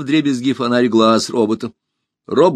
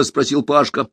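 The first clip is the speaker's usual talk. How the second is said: usual speaking voice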